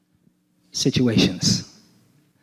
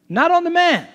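emphatic point; 14 semitones higher than usual. Real, not acted. Preacher punches point.

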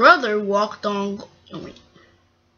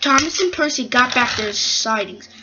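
usual speaking voice

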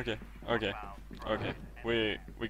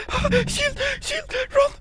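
panicked yell; 9 dB louder than usual. Real, not acted. Panicked calls during chase.

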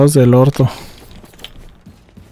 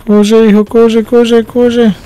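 high, hurried urging to run; panicked run-run-run; urgent panic; 7 semitones higher, much slower than usual. Real, not acted. Fleeing a monster in game. (apuro con pánico)

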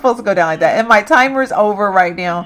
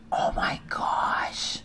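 slow breathless whisper; whispering in disbelief at the death; stunned whisper; 12 dB quieter, much slower than usual. Real, not acted. Sim suddenly dying.

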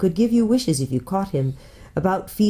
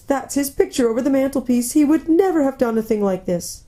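higher, plaintive faun voice; mournful faun; 7 semitones higher than usual. Acted. Faun comparing himself with his father, mournful. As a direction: lament in a tearful character voice.

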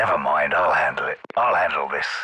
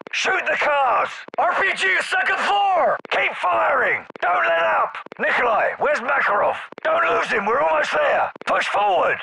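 battle shout; 6 semitones higher than usual. Acted. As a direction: roar orders over gunfire. Assault, shouting under fire.

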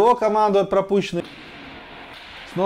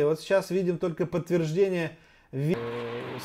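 ordinary talk

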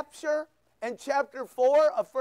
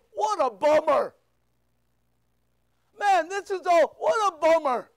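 mock complaining; 3 semitones higher, 5 dB louder than usual. Mocking a gloomy attitude Paul lacked.

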